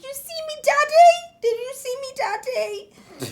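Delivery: put-on very high squeaky child voice calling for Daddy; high-pitched child's plea; excited little boy. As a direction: play a small kid begging Dad to look. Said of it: "Luke runs to his dad, and he's like".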